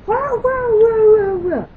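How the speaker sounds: loud high slow barking wows; mock dog barks. Girl imitating her dog barking at the stranger, loud and high. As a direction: bark the wows like an angry dog.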